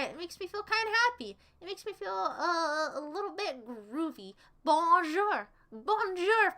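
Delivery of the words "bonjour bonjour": mock French greeting. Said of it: "I'm not French".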